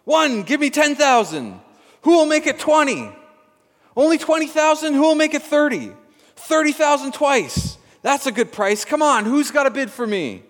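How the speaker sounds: excited auctioneer. A narrator's reading. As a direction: whip the crowd into bidding. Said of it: "The auctioneer seized the moment and cried out".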